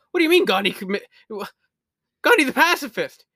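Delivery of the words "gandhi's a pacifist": loud, much higher pacifist question; shocked disbelief. Real, not acted. Gamer learning Gandhi is aggressive, shocked. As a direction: gasp it out amazed.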